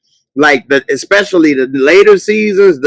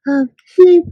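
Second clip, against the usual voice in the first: mocking a character. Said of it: "they just be like".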